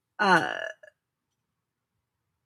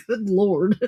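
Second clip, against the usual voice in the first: Southern drawl. Acted. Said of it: "prone to breaking out in a drawl".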